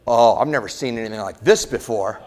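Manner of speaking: low-pitched mock pilot; lightly acted pilot, dropping the voice in dismay; worried pilot. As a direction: imitate a pilot baffled over the intercom. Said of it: "on the airplane and he goes".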